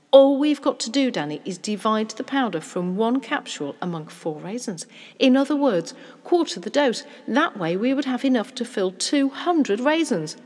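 animated father with a solution; excited scheming father. Acted. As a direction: reason it out aloud, triumphantly.

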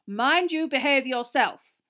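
slow, high, loud pompous prefect; Percy impression. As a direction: mimic a stuffy older brother. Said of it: "an uncanny impersonation of Percy".

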